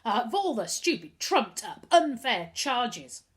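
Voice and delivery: put-on boy venting rage once safely outside; exploding in anger. A narrator's reading. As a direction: let it burst out of him. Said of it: "he exploded".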